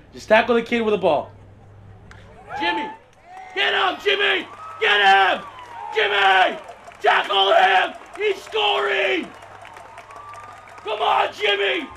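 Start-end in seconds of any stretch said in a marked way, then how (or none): 2.6-11.9 frantic sideline screaming. Acted. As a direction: scream like a desperate youth coach. Coach screaming at kid on field.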